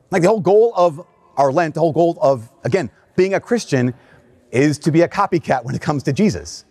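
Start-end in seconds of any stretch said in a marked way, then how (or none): none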